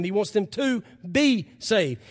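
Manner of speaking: ordinary delivery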